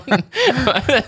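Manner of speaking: high-pitched burst of laughter. Real, not acted. Laughing at own joke.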